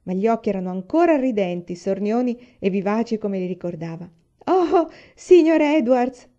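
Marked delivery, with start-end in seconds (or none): 4.5-6.3 high, loud, stretched-out shout; big storybook cry of greeting; delighted cry. A narrator's reading. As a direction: cry out slowly to a welcome guest. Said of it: "gridò".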